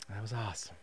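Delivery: breathless whispered aside. Retold or imitated. To himself after the workout, whispered. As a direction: barely voice it, spent.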